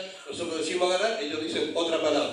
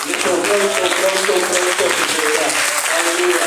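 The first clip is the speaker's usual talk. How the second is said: loud, rising preacher's cry; fervent shout; 8 dB louder than usual. Real, not acted. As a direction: proclaim it with full force to the church. Preacher exclaims to congregation.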